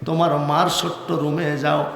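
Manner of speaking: normal talking voice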